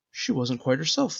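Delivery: plain reading voice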